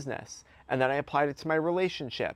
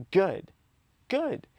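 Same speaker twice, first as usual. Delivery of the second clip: "Good" punched high and loud, then "good" higher still; emphatic "good"; 7 semitones higher, 3 dB louder than usual. Acted. Defying addiction's last-ditch effort, emphatic "good". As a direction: hit "good" twice with a pause between, pitch jumping up. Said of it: "emphasis on the word good".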